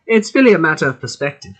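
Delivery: a little bit of German accent; shaky-quality attempt at a German accent; slight German accent. Shown as a demonstration. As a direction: try a passable but weak German accent. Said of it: "There's a little bit".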